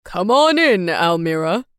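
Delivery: a kindly father calling in; dad voice. A narrator's reading.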